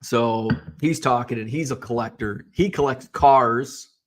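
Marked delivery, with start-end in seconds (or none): 2.5-3.9 "cars" drawn out slowly; deliberate, lengthened "cars"; stress on cars. Real, not acted. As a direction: stretch "cars" so it can't be heard as cards. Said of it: "without the D".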